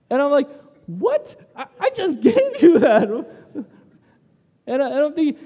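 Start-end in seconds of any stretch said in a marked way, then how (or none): 0.9-3.1 high, loud, laughing incredulity; squeaky, hammed-up indignant surprise; mock disbelief. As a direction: protest in comic outraged astonishment. Acted disbelief at daughter's refusal.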